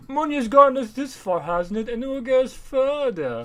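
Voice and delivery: high, pompous moneyed voice; mock rich guy. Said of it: "the rich guy's like".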